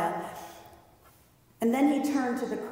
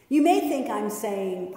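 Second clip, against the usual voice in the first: ordinary talk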